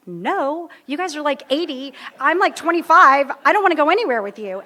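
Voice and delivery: put-on loud, high, scoffing young brat; bratty refusal. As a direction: brush them off like a snotty kid. Mocking old timers' invite, brash refusal.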